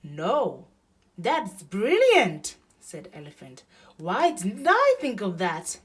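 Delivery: acted delight at a clever idea; delighted Elephant. A narrator's reading.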